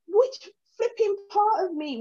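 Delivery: incredulous. Retold or imitated. Retelling her reply to a stranger.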